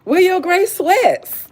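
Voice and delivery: high, loud, slow tease; teasing call-out. Pretend. Streamer teasing a male viewer, slow and loud. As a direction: drag it out flirtatiously.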